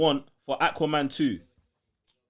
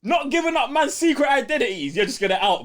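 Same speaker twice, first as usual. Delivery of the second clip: high, louder mock indignation through a laugh; mock outrage; 11 semitones higher, 4 dB louder than usual. Real, not acted. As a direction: play it up as a betrayal. Mock outrage at outing Eric.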